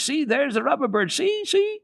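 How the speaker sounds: put-on very high, loud excited child; girl shouting excitedly at a bird; excited cry. A narrator's reading. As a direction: point and shriek with delight. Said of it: "cried Heidi at the top of her voice".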